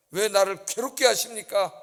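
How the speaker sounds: loud, pointed cry stressing 나를; Moses complaining. Acted. Preacher voicing Moses protesting to God, loud. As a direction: throw the question at God in protest. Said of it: "하나님한테 뭐라 그래요?".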